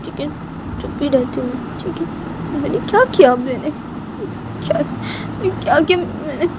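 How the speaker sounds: put-on squeaky high girlish protest; childish protest. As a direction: protest your innocence like a sulky girl. Bani gives in, near tears.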